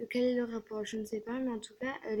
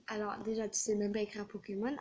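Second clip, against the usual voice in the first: ordinary talk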